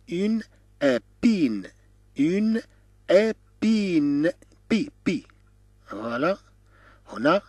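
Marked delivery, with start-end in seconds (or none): 0.1-5.3 "Une épine" slowly, high, with gaps between syllables; syllable-by-syllable demo. Shown as a demonstration. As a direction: draw out "Une épine", then isolate "بي، بي". Teacher splits word for learners. (نطق "Une épine" ببطء مقطعًا مقطعًا)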